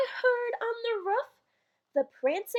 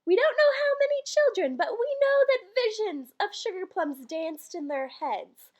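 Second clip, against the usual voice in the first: ordinary delivery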